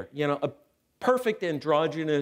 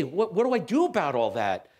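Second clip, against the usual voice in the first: ordinary talk, nothing marked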